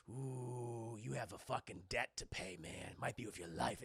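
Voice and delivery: low, hushed threat; menacing whisper. Retold or imitated. Rival racer warning loser of his debt, menacing whisper. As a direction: sink into a sinister, mock-villain whisper.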